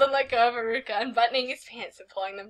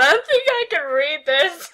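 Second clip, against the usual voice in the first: laughing; 6 semitones higher, 7 dB louder than usual. Real, not acted. Reading fanfic aloud, cracking up.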